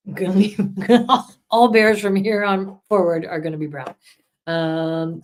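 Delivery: ordinary delivery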